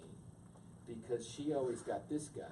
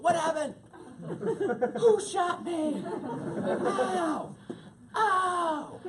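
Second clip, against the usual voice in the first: much higher, louder hammed-up cries; pained startled yelling; 10 dB louder, much slower than usual. Waking from the slap, loud pained yells. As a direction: yell as if shot.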